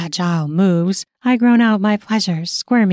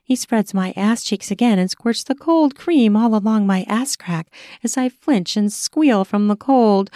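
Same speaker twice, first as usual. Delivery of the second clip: ordinary delivery, nothing marked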